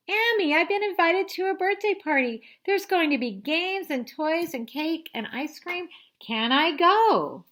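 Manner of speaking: high, loud, thrilled begging; a child begging to go to a party; excited kid. Acted. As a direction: bounce with excitement.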